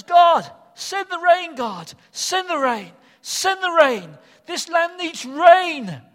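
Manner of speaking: loud, pleading cry to God; desperate crying out. Acted. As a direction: beg for rain at the top of the voice. Elijah's desperate prayer acted out.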